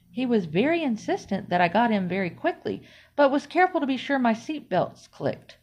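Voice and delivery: plain reading voice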